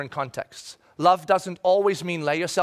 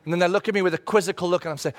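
ordinary talk

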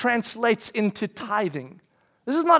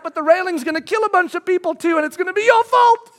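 anxious inner voice; 9 semitones higher, 5 dB louder than usual. Retold or imitated. Fear voice argues on the ledge.

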